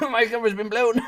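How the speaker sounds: line said through laughter; laughing. Acted. Streamer repeating his joke, laughing through it. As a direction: repeat it cracking up.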